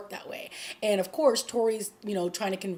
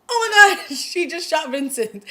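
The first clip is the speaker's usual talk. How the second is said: loud, high-pitched shocked exclamation with a laugh; shocked outburst; 7 semitones higher, 8 dB louder than usual. Retold or imitated. Viewer reacts to Catherine shooting Vincent, shocked outburst. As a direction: blurt it out in mock horror, laughing.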